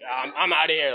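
imitating a flustered exit; mock panic. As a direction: blurt it out like you want to escape. Acted urge to flee awkward scene.

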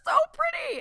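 squealed high and loud; mock gushing compliment. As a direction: gush it like a fawning friend. Mocking a friend's gushing reassurance.

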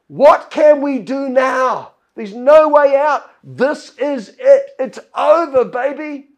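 a frightened servant who sees no way out; servant cries out. Acted. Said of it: "the servant cries to Elisha".

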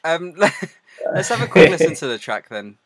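loud and high through laughter; loud laughing. Real, not acted. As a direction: announce it while laughing.

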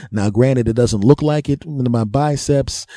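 normal talking voice

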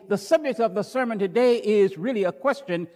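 usual speaking voice

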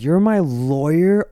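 high, loud, drawn-out disbelieving question; retold incredulity. Client reacting to his new lawyer, retold incredulity. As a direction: stretch it upward in mock disbelief.

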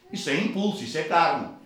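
ordinary talk

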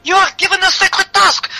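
high-pitched outraged barmaid; crying out. Acted. Barmaid rebukes the child's minder, high cry. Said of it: "cried the barmaid".

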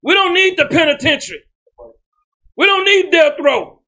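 high, emphatic declarations with pauses between; full-force preaching. Real, not acted. Preacher rejecting prison before his congregation, full force. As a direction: proclaim each line to the congregation, pitched high.

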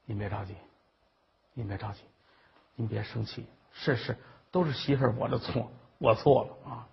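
low, soft apology; meek placating. Acted. Daughter-in-law placating her scolding mother-in-law, meek and soft. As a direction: soothe her submissively, keeping it low and soft.

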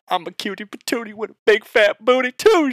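high, loud playful hype; hammed-up high-pitched gushing; mock gushing. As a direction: belt it out high, hamming up the admiration. Mimics her supposed flattering thought.

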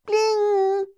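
high, bright sparkle sound effect; magic bling. Acted. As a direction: sing out a magic chime. Magic sound as prince appears.

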